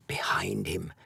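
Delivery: an echo fading away; echoing back quietly. A narrator's reading.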